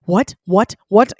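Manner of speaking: loud, stunned repeats; glitching in shock. Retold or imitated. Woman retelling her shock at the proposal, loud and glitching. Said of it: "I was, like, glitching".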